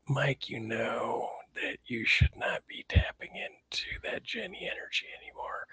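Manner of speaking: soft whispered warning; hushed friendly caution; whispered advice. Retold or imitated. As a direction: lean in and whisper it like a secret. Friend's quiet advice retold.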